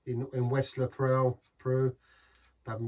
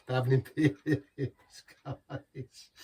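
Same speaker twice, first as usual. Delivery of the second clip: mock German accent; much slower than usual. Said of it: "Terrible German accent there".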